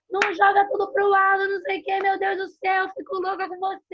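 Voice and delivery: high-pitched acted telling-off; scolding parent. Exasperated mother telling off her child, scolding. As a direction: rattle off a hammed-up scolding. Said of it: "Antes de sair dando a bronca".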